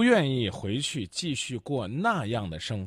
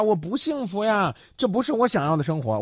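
mock crying caller; 8 semitones higher than usual. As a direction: lightly whine it like someone on the verge of tears. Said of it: "你只是在哭呀".

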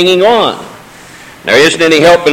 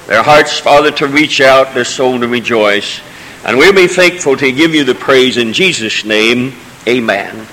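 earnest pastor's prayer, below his usual pitch; 4 semitones lower than usual. Acted. Pastor praying for anointing before the sermon, earnest prayer voice. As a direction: pray over the congregation before preaching.

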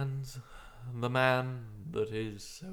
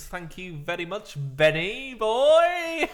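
mock announcer voice; 9 semitones higher, 6 dB louder, slower than usual. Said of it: "that was really good".

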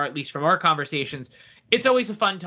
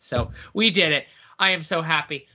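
usual speaking voice

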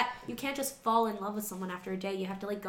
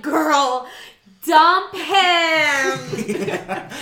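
sassy advice; 7 dB louder, much slower than usual. Retold or imitated. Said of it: "I'm like".

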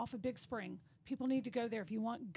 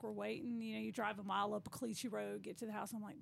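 ordinary talk

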